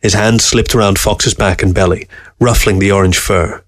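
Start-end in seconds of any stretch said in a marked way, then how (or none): none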